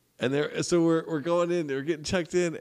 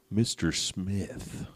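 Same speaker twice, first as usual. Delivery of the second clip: slow, drawn-out alias; 5 semitones lower, 4 dB quieter, much slower than usual. Pretend. Giving the guest an alias.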